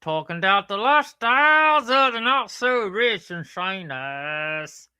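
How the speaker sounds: slow, loud, high Southern-tinged announcement; accent slipping into Southern. Shown as a demonstration. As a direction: drawl it grandly in a put-on Southern accent. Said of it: "Now you got a southern accent".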